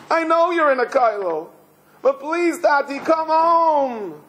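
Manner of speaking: put-on high child's plea, drawn out at the end; pleading little boy. Boy begs his father in prison, high drawn-out pleading. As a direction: implore high, imitating a heartbroken child. Boy's plea retold in English.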